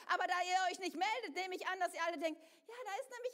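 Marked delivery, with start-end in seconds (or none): none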